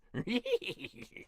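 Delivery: a burst of amused laughter; high laugh. Real, not acted. Voice actor laughing at his own clown voice.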